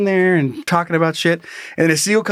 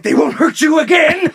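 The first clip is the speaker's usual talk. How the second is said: high, shouted mock-heroic vow; vengeful dad; 10 semitones higher, 3 dB louder than usual. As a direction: shout it like an overwrought protector. Acted dad vowing to daughter.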